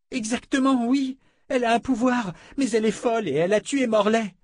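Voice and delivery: put-on high-pitched panicky young man; frantic insistence. A narrator's reading. Frightened Fitch insists on the danger.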